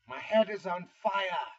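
loud, high hammed-up British accent; mock British accent. Vlogger jokes about the burning head effect, mock British accent. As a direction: exclaim it in an attempted British accent. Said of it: "an actual British accent".

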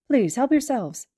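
a courteous, gently cheerful headmaster; Nezu's voice. Acted. Nezu offers cookies.

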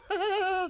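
put-on high, loud burst of boyish laughter; laughter cut short. A narrator's reading. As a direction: burst out laughing, then stop dead. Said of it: "immediately the laughter stalled".

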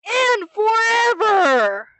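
high, slow roaring shout; slow booming villain cry; triumphant villain shout. Acted. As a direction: bellow it like an evil vow. Villain gloats about Katie.

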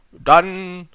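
high, loud, pompous king; befehlender König. A narrator's reading. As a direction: proclaim it like a monarch. King gives an order. (lauter, hoheitsvoller König)

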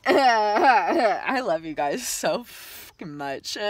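slow, tearful laughing words; laughing through tears. Real, not acted. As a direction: laugh and tear up as you say it. Emotional thanks, laughing and crying.

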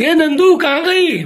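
high, drawn-out call; where-are-you call, pitched high. Real, not acted. Wondering aloud where Nandini went.

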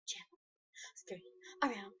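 soft breathy whisper. Real, not acted. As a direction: whisper it secretively. Whisper then normal repeat.

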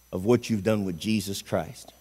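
normal talking voice

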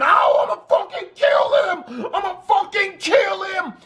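high, strained tearful scream acting out his brother. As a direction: mimic a screaming, crying rage. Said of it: "punching holes in the fucking walls by screaming".

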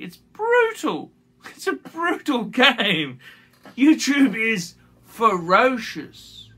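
high, slow, half-laughing stress on brutal; emphatic. Real, not acted. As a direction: drive home how harsh it is. Emphatic about YouTube.

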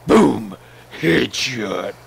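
loud boom headshot call; triumphant shout. Real, not acted. Teammate celebrating a headshot, loud triumphant shout. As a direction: yell it out after the kill. Kill shot in the game.

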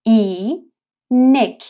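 اینک stretched very slowly; slow syllable demo. Shown as a demonstration. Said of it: "با دهان باز و کشیده". (اینک آهسته و کشیده)

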